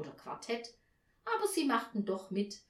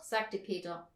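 usual speaking voice

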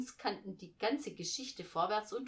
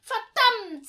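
high, very loud curse; outraged outburst; 16 dB louder than usual. A narrator's reading. Girl jumps up at news of no room, outraged. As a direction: explode with indignation.

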